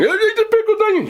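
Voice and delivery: high squeaky shout; mock viewer yell. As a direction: yell it like an impatient fan. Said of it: "y'all probably screaming at the damn thing".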